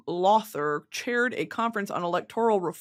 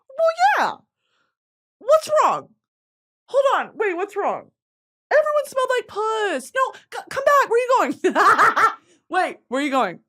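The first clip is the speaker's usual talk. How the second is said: mock panicked shrieking; 12 semitones higher, 5 dB louder than usual. Acted scene, people fleeing her smell.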